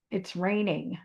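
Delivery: just state it, no drama; calm acceptance. Retold or imitated. Teacher shows calm detachment about rain, in a soft, even voice. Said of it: "you see that it's raining and you say".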